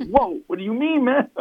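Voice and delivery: high-pitched retold astonishment; startled reaction. Acts out his startled reaction.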